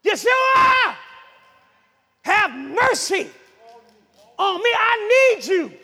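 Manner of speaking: high, loud drawn-out cry for mercy; acted beggar's holler. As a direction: call out desperately over a crowd. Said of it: "And he was hollering out".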